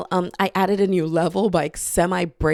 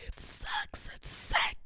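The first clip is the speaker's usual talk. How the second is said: whisper; 16 dB quieter, slower than usual. Pretend. Whispered aside.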